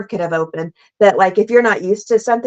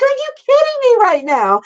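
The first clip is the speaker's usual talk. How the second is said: much higher, excited disbelief; incredulous disbelief. Retold or imitated. Telling the producer off for missing the shot, high incredulous squeal. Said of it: "And I'm like".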